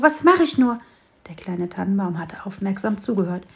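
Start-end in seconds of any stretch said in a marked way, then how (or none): 0.0-0.8 even higher and louder on "mach", falling off; the repeat boils over into real despair; the sparrow's frantic second cry; more desperate sparrow. A narrator's reading.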